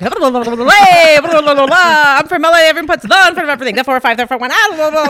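fast, loud auctioneer babble. Retold or imitated. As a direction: rattle it off like an auctioneer. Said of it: "I sounded like an auctioneer".

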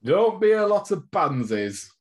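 high, slow hammed-up gruff manager; mock manager rant. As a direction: bark it like an old-timey coach. Mock old-school manager rant.

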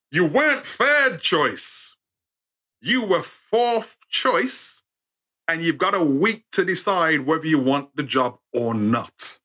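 drawn out, each choice weighed; reading out the insult slowly; slow emphatic reading. Retold or imitated. To his audience, the letter read slowly and pointedly.

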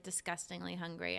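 usual speaking voice

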